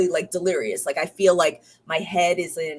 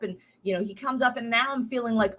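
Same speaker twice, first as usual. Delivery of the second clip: normal talking voice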